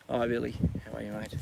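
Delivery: real hushed tenderness to an animal; soft greeting. Real, not acted. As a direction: talk softly so the horse stays calm. Greeting the young horse.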